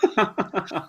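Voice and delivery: high burst of laughter. Real, not acted. Friend laughing as the guest signs off, laugh.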